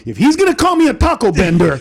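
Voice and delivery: laughing retort to the crowd. Retold or imitated. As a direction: laugh through the comeback. Stage retort, laughing, higher.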